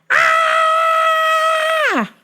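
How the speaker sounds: very high, sustained mock scream; long shriek. Woman in traffic, long high crazed scream.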